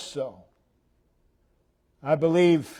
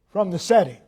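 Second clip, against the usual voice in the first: normal talking voice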